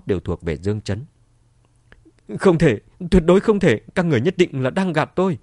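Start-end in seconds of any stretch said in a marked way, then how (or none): 2.4-5.4 loud, high-pitched refusal; shocked denial. A narrator's reading. Wife accuses everyone of lying, shocked denial. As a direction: cry out that it cannot be.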